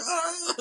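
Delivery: very high mock celebratory "ah"; imitated whoop. Two inmates celebrating before hugging, high mock yell. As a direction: squeal out an imitated cheer.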